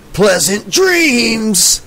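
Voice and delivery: high, drawn-out horror-host farewell; menacing sign-off. Acted. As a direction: stretch it out slowly as a spooky goodbye. Horror narrator's spooky sign-off.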